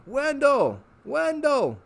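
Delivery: high calling shout; yelling a name. Acted. Harvey calling out for lost Wendell, high shout. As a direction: call across the lawn for him. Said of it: "cupped his hands around his mouth and yelled".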